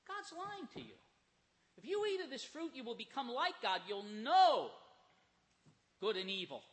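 lightly voiced tempting devil, sly and rising; a hint of the sly serpent luring Eve. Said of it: "the devil comes in and he says".

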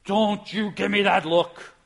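hammed-up parental glare in God's voice; mock scolding God. God imagined scolding the angels, loud and slow. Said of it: "God has to say".

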